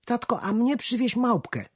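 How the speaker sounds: put-on sweet little girl pleading; little daughter asking. A narrator's reading. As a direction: daughter asking her dad for a monkey, pleading. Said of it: "prosiła córeczka".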